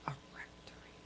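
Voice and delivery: whispered aside. Real, not acted. Muttering to herself, baffled.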